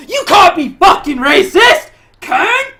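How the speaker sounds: high, loud furious yell; mock angry yell. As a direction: shout at them in outrage. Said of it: "Now it's like".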